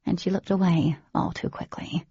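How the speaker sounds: usual speaking voice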